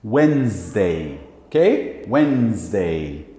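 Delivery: Wednesday low and slow, wenz-day; slow model. Shown as a demonstration. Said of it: "como que você fala". (Wednesday lento e grave)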